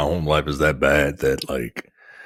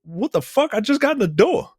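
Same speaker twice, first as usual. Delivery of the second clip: much higher, yelling indignant husband; Chris Rock bit. Retold or imitated. Husband just home from work, outraged: high mock yell. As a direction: squeal it like a fed-up husband in a stand-up bit. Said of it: "that Chris Rock bit".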